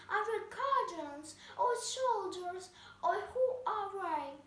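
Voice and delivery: angry queen. A narrator's reading. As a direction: demand it like a furious queen. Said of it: "cried the queen angry".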